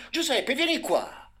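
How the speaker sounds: high, loud, slow shouted call; family yelling for the boy to come back; desperate yell. A narrator's reading. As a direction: yell him back from danger. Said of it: "Kevin e suo padre le fecero coro".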